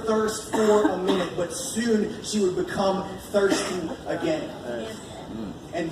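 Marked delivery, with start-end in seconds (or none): none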